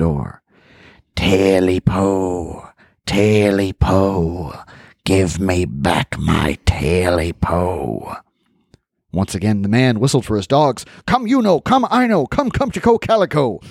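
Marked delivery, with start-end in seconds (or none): 1.1-8.2 slow, low eerie creature chant. Acted. Creature calling through the door. 11.1-13.6 high singsong dog call. Acted. Man whistling, calling his dogs.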